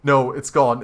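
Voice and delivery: panicked realization. Acted. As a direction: blurt it out in sudden panic. Realizes the gun is gone.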